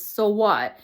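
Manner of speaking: loud, dismissive "So what?"; inner critic voice. Retold or imitated. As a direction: snap "So what?" loudly as the harsh inner critic. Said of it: "another voice that says".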